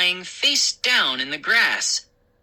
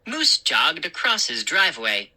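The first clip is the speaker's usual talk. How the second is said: ordinary delivery, nothing marked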